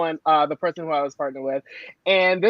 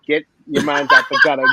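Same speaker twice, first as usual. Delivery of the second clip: playful scold; 3 semitones lower, 6 dB louder, faster than usual. Acted. Teasing the audience.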